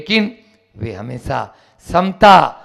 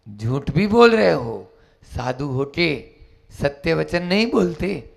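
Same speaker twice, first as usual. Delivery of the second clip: normal talking voice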